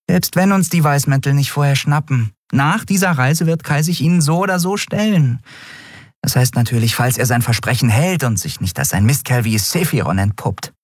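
high-pitched little character, explaining; disapproving Nelen. Acted. Nelen doubts Kai will stay, high and sceptical.

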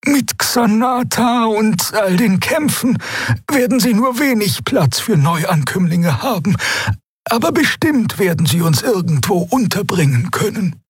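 very high-pitched bird character; high, careful creature voice. A narrator's reading. Xea, bird figure, in dialogue.

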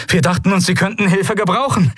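high-pitched call over the fighting; breathless call. A narrator's reading. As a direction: call back to your admiral mid-battle. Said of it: "rief Novus atemlos".

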